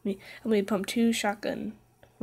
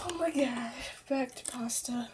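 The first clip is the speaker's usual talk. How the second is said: unvoiced, softer whisper of mock awe; mock shocked whisper; 4 semitones higher, 7 dB quieter than usual. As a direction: hush it like a scandalous secret.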